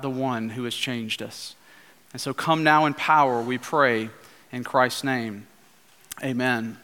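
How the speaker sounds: pastor leading the congregation in hushed prayer. Acted. Pastor prays before the sermon.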